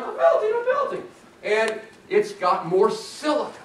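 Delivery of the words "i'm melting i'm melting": high squealing I'm melting; melting witch. Pretend. Professor voicing subducting rock as a melting witch, high squeal.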